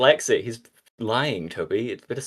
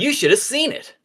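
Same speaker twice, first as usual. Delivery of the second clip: high Sonic voice; 14 semitones higher than usual. Acted.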